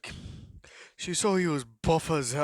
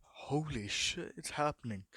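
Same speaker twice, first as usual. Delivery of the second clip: slow whisper to himself; inner-voice whisper; 8 dB quieter than usual. Acted. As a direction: whisper it like a thought nobody should hear. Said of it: "in his mind".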